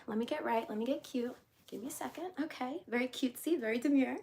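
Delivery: soft murmured aside; quiet aside. Real, not acted. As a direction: mumble to yourself while fixing up. Quiet aside while fixing herself.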